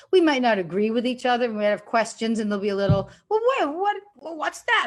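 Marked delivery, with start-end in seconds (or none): 3.3-4.9 much higher, quizzical put-on voice; lightly played baffled colleague; mock puzzled questioning. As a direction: act out a castmate raising a doubt. A castmate questioning a choice in rehearsal.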